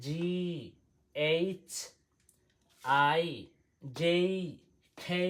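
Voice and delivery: letter by letter, pause after each; alphabet model reading. Shown as a demonstration. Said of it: "এক পলকে দেখিয়ে দিই". (একটা একটা করে স্পষ্ট অক্ষর পড়া)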